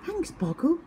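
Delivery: high, puzzled and innocent; confused little owl voice. A narrator's reading. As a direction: stammer it in wonder. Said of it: "Twit cried".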